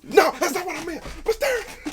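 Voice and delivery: high, loud promo shout; wrestling promo. Acted. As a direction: laugh through the promo. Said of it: "wrestling promo".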